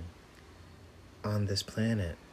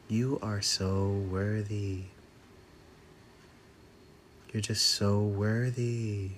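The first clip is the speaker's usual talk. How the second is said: guided affirmations. Acted. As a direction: let each line land with long pauses.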